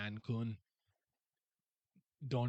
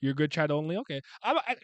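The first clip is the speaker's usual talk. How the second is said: normal talking voice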